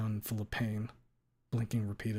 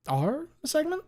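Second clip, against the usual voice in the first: much higher doubting question; probing lawyer; 4 dB louder than usual. Acted. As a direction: challenge her answer.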